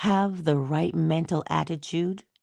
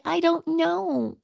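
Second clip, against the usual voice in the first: higher, slow mock shrug of an answer; dismissive excuse; 12 semitones higher, much slower than usual. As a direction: imitate the lazy excuse, drawn out. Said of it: "we're so quick to say".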